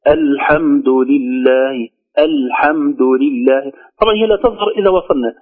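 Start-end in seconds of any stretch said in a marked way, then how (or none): 0.1-3.7 slow, drawn-out الحمد لله with the opening hamza sounded; clear initial hamza. Shown as a demonstration. Teacher showing how to begin the verse, hamza on الحمد clearly sounded. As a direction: start الحمد on a clear glottal a, measured recitation. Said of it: "بل لابد أن نقول".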